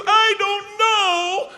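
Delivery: put-on high, drawn-out little-kid wail; panicked little boy. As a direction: wail it slowly like a panicked child. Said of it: "he's still panicked".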